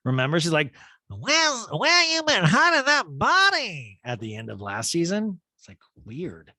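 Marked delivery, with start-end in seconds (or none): 1.1-4.0 creepy, high, loud drawn-out impression; slow, leering high-pitched mock voice; Erica. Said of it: "weirdly creepy with Crystal's body".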